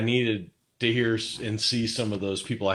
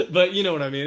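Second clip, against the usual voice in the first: laughing; 8 semitones higher, faster than usual. Real, not acted. Guest still chuckling as he asks the host, amused. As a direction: let the giggle trail into the question.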